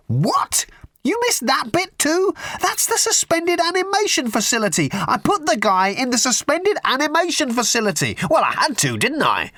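very high-pitched frantic screech; screaming. A narrator's reading. Ford explains the ice cubes to Arthur, screaming. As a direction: shriek in exasperated disbelief, easing at the very end. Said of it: "screamed Ford".

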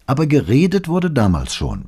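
insisting, pitched well up; insistent. A narrator's reading. Paola holds her point against her husband, insistent. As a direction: hold your point firmly. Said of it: "beharrte Paola".

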